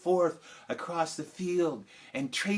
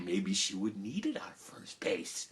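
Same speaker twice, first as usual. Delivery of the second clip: whispered, lower and softer; hushed aside; 9 semitones lower, 8 dB quieter than usual. A narrator's reading. Storyteller confiding a little joke to the audience, whispered. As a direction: let it slip as a secret aside.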